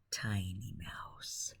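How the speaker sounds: ordinary delivery, nothing marked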